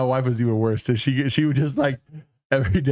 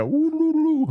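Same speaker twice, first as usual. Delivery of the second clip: high falsetto woo sounds; mock magic woo; 3 dB louder, much slower than usual. A friend mimics wizards casting hand magic, high falsetto woos. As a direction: squeal it like a goofy wizard casting.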